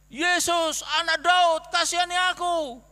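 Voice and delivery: loud, high desperate cry, refusing to be hushed. Acted. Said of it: "suruh diam tapi tetap lebih keras ya".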